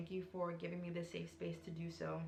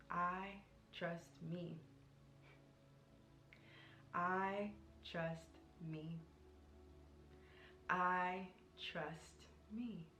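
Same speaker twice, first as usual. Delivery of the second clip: slow, softer, spaced out with long pauses; slow soft affirmation; 5 dB quieter, much slower than usual. Shown as a demonstration. To her viewers, sharing today's affirmation, slow and softer. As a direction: let each word land, gently, like a mantra. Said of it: "the affirmation is".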